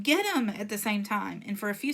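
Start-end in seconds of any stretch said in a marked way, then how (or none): 0.0-0.5 loud, high chase cry; screamed. A narrator's reading. A bully calling to catch the kids, screaming. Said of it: "someone else screamed".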